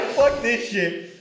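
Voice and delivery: slow, laughing, drawn-out voice; mock character voice. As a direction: drag it out, laughing. Voicing Aang dying.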